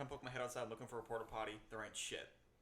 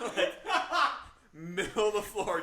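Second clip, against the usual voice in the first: loud laughing; 6 semitones higher, 12 dB louder, slower than usual. Real, not acted. Laughing retelling his gross act.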